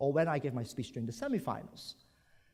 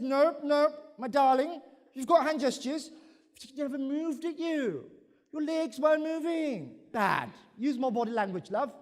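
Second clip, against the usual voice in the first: higher, louder condescending coach; patronizing evaluator; 10 semitones higher, 4 dB louder than usual. Retold or imitated. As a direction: talk down sweetly to a pupil. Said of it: "the evaluator says".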